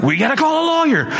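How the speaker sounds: frantic, very high-pitched retold outburst; losing his mind. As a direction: come unglued, voice shooting way up. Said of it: "I'm losing my mind".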